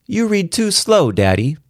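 put-on high, whiny little girl; a child grumbling at her dad; complaining child voice. A narrator's reading. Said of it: "she complained".

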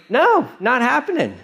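high-pitched mock refusal; defiant refusal. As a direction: play a cocky disciple refusing outright. Acting Peter flatly refusing Jesus.

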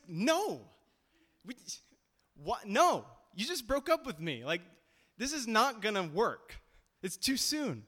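put-on exasperated ex-girlfriend. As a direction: pitch it up and turn him down flat. Girl refusing ex's coffee request.

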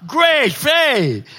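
high, loud and triumphant; exultant great faith. Acted. Preacher acts out the congregation's high moment of faith, exultant. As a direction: ride the high of a roller coaster.

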